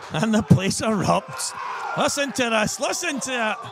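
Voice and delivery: high, loud shouting over the crowd; thrilled commentary shout. Real, not acted. Commentator telling viewers to hear the crowd erupt, thrilled shout. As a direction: shout it in amazement at the roar.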